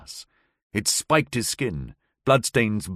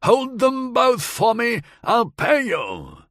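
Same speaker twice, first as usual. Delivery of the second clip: much higher, slow, shouted across the water; shouting; 12 semitones higher, slower than usual. A narrator's reading. Howard bribes the watermen from the river, slow shouted order. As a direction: bellow the offer from far off. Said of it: "Howard shouted at the watermen".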